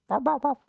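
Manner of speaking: high playful woof woof woof; mock dog barks. Streamer barking at a dog picture, high and playful. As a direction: bark like a little dog at the screen.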